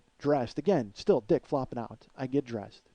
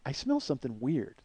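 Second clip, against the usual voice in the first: ordinary talk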